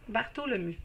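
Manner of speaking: French pronunciation. Shown as a demonstration. Reader practising the client's name in French pronunciation. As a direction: say Bartholomew with a French accent, T for TH. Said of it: "See, that sounds good like that".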